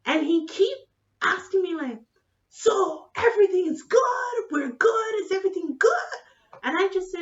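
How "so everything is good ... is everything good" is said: loud, high badgering; insistent drunk doctor. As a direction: keep pressing in a raised, shouty voice. Drunk doctor keeps pestering her.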